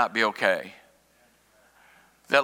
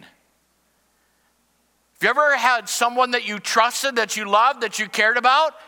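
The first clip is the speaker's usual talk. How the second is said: raised, driving pulpit delivery; fervent sermon voice; full-force preaching; 8 semitones higher than usual. Acted. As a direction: preach it hard to the congregation.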